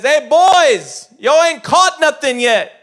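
loud, high Southern-style holler; folksy calling out. Acted. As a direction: call out to the boys across the water. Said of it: "A better translation is".

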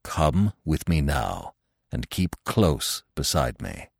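slow, low, deliberate and firm; emphatic. A narrator's reading. Old stag leads wounded Bambi away, slow and emphatic. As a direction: press each word home calmly. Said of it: "breathing deeply and speaking emphatically".

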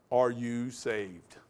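slow, searching question; earnest question. Retold or imitated. Pastor asking Tim in the back room, slow earnest question. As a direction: ask it slowly so it lands.

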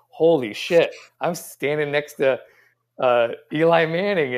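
high, loud, acted amazement; acted starstruck awe. Star rookie standing next to Eli Manning, acting starstruck. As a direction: play a rookie stunned beside his idol. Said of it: "and he's going".

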